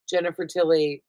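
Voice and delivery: ordinary talk, nothing marked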